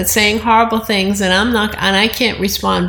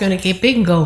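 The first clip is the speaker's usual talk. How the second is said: ordinary talk, nothing marked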